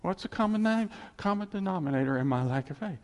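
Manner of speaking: normal talking voice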